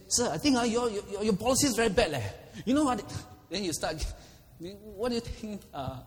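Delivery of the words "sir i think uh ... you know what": much higher and louder, laughing through it; hammed-up brash citizen telling off the Prime Minister; mock rude complaint. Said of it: "would you say".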